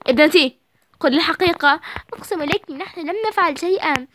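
scolding mother. Acted. Mother scolding her daughters for lying, angry. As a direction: demand the truth.

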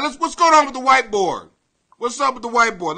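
ordinary delivery

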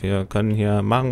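normal talking voice

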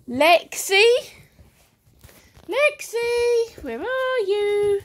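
high sing-song call; searching call. Real, not acted. Calling lost puppy.

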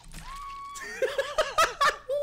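very high squealing laugh; shrieking laugh. Real, not acted. Streamer watching a survivor hide, squealing laugh. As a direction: burst out in disbelief.

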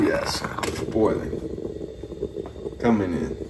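soft unvoiced whisper. Real, not acted. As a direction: whisper it in awe. Peeking at the boiling pot.

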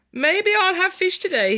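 high, loud crocodile giving up; storybook crocodile. Acted. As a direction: toss it out loudly as the crocodile. Crocodile's line acted in story.

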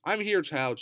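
ordinary delivery, nothing marked

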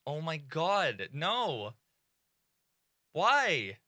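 ordinary talk, nothing marked

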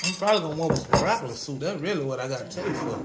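loud. Real, not acted. Reply across the kitchen.

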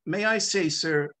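normal talking voice

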